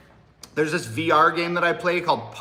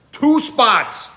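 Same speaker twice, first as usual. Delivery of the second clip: raised, loud and punchy; emphatic hype; 8 semitones higher, 5 dB louder than usual. Real, not acted. Streamer telling fans how close number one is, emphatic hype. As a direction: call it out like a big headline. Repeats the chart position emphatically.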